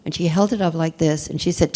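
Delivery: usual speaking voice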